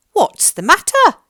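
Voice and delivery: lifted concerned question; George's voice. A narrator's reading. George asks the fox.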